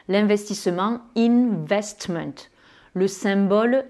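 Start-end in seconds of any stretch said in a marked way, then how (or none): 1.2-2.3 "investment" slowly, with a long first N; sounded English N. Shown as a demonstration. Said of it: "ils vont prononcer ce N ou ce M". (« investment » lentement, N bien prononcé)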